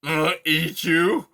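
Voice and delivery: put-on loud grumpy old creature voice; gruff old porcupine. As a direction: grumble like a cranky old porcupine. Aerith speaking.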